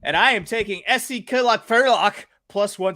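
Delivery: high, hammed-up Austrian accent; mock Austrian accent. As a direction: roll out the Austrian names like a proud local. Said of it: "Your pronunciation improved".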